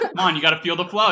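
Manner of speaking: fast, loud laughing tease. Real, not acted. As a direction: laugh while urging her on. Teasing cohost into hosting.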